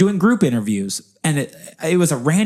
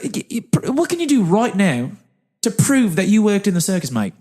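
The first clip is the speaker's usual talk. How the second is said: higher, challenging bad New Zealand accent; Richard; 7 semitones higher than usual. Interviewer challenging circus performer to prove it, New Zealand accent. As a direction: demand proof in an amateur Kiwi accent. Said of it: "do a very bad New Zealand accent".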